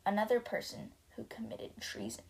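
hushed, gloating guards; whispered gloat. Acted. Guards whispering smugly about another arrest. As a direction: whisper the sneer.

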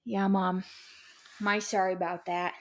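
a lightly voiced little boy, sheepish and low; imitating her little son. Mother imitating her son apologizing for peeing, lightly voiced little boy. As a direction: mimic a small kid mumbling his apology. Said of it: "That's exactly how he sounds".

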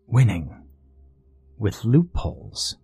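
usual speaking voice

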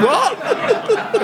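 squeaked high in disbelief; a shocked, laughing, high-pitched what; stunned what. Retold or imitated. Told the boyfriend moved next door, shocked squeak.

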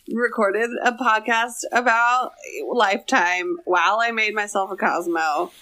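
ordinary talk

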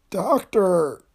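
high, moaned plea; moaning. A narrator's reading. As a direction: moan for a doctor in pain. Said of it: "the lawyer moaned".

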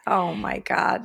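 slow, drawn-out horrified gasp of words; mocking dismay. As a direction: echo her dismay teasingly. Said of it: "don't say it like that".

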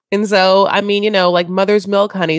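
ordinary delivery

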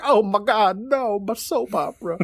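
high, hammed-up wail; imitated distraught reaction. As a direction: wail in mock despair. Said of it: "Just her reaction. She's like".

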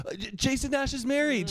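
put-on high, awkward drunken blurt; mock tipsy squeak. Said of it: "I just go".

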